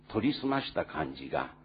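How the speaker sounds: usual speaking voice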